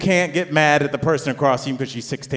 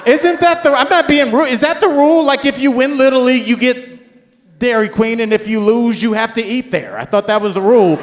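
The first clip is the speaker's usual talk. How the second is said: high, loud, incredulous comic rant; hammed-up exasperated questioning; indignant protest; 10 semitones higher, 7 dB louder than usual. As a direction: plead the case at a raised pitch. Dad keeps protesting the suggestion.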